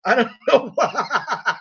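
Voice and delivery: words breaking up into laughter; real laughing. Real, not acted. Vlogger baffled by own running joke, laughing. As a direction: lose the last word in a laugh.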